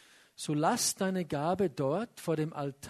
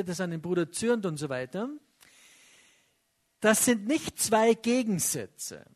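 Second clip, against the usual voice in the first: ordinary talk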